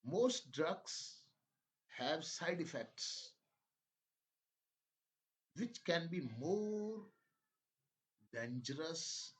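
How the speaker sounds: very slow, emphatic reading in separated phrases; dictation-style reading. Shown as a demonstration. Teacher dictating a health passage to English learners, slow emphatic reading. Reads passage for listening practice.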